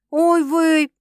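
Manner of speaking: drawn out. A narrator's reading. Sister mildly drawing out oy vey. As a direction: drag the exclamation out. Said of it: "протянула сестра".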